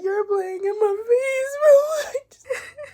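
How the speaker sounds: high sobbing shout, slow and breaking; crying shout. Real, not acted. She cries out loud at someone playing in her face. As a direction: wail it out through real tears.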